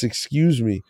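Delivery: normal talking voice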